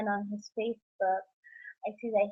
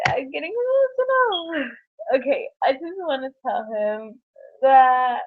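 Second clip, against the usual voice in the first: real crying, pushing the words out slowly; emotional; 3 semitones higher, 6 dB louder than usual. Real, not acted. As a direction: voice cracking high and loud with tears.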